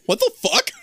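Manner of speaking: loud, very high comic disbelief; mock bewilderment. Acting Nana reacting to the outburst, high mock shock. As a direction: squawk it high, as if stunned.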